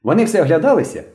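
put-on high-pitched excited boy; agitated report. A narrator's reading. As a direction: report eagerly to the leader in a high voice. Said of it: "знову швидко заговорив білобрисий".

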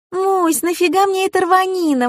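very high, scornful daughter voice; young girl. A narrator's reading. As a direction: pipe it out as a dismissive child.

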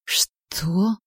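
puzzled, softly asked question; a touch of bewilderment; bewildered. A narrator's reading. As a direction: ask back in mild disbelief. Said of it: "в недоумении переспросила я".

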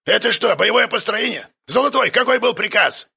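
rip into the sloppy squad; scornful instructor. A narrator's reading. Instructor mocks the recruits' formation, scornful.

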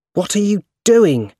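high, loud angry demand. A narrator's reading. Harry angrily confronting his father, high and loud. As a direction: confront him sharply. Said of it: "he asked angrily".

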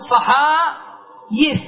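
صحايف with long "haa", then "yif"; original yaa form. Shown as a demonstration. Teacher showing the underlying plural, صحايف with clear yaa. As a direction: stretch the aa, glide into y in صحايف. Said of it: "هذا الأصل".

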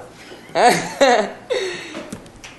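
long, high burst of laughter. Real, not acted. Se ríe al contar. (carcajada larga y aguda)

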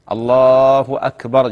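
slow takbir with long held الله; drawn-out muezzin-style demonstration; correct adhan call. Shown as a demonstration. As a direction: call it out steadily, short final أكبر. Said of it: "يؤذن بهذه الطريقة".

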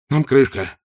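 plain reading voice

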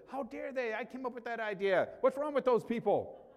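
imitating his own selfish huff; mock indignation. Pastor acting out his selfish first reaction, mock outrage. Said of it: "My selfish response was".

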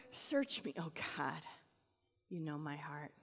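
soft, low, intimate prayer; hushed prayer. Acted. David asking God to search him, hushed. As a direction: pray it quietly, as if alone with God.

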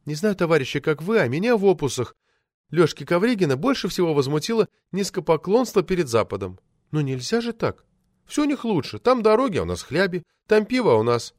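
indignant woman speaker. A narrator's reading. Woman complaining to party committee about admiring the West, indignant. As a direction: lift the voice for an aggrieved speech. Voices Metelina at the meeting.

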